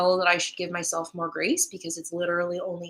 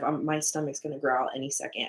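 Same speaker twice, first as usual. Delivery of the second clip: usual speaking voice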